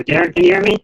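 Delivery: high, loud call into the mic. Real, not acted. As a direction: call out to check he hears. Mic test calling out to Darren.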